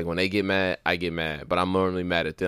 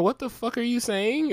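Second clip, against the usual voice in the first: slow, much higher baffled voice; mock confused listener. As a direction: play someone lost by the slang. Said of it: "if I talk like this".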